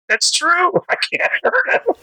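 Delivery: high and laughing, half in disbelief; giddy laughing admission. Retold or imitated. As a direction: laugh it out as if stunned. Inner voice admitting defeat.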